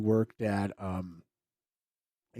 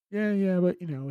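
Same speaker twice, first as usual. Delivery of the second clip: brushing it off; 9 semitones higher, 6 dB louder than usual. Retold or imitated. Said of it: "It's like".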